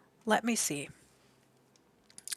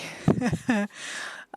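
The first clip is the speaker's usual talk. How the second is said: teary laugh; 4 semitones higher than usual. Real, not acted. Tearful laugh on stream.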